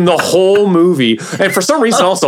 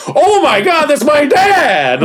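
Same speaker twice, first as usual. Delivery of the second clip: mock kid shout; 13 semitones higher, slower than usual. Retelling the movie, the kid's hammed-up shout of surprise. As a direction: scream it like a stunned kid. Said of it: "they're like".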